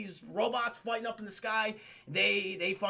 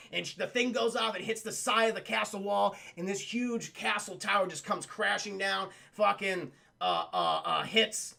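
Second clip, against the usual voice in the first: usual speaking voice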